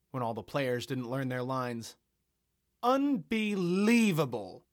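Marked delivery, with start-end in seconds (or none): 2.8-4.6 high, loud, slow outraged cry; loud indignant. Acted. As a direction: drag out her outrage at the unfair result. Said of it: "she said loudly".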